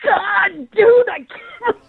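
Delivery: high, helpless cry of relief; real stunned exclamation, cracking up; laughing disbelief. Real, not acted. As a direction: voice collapsing into laughter. Victim learns it was a prank.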